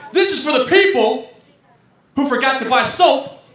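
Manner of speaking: rally the crowd like a rock frontman; lead singer. Acted. Lead singer addressing the concert crowd, hammed-up dedication. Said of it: "a little dedication".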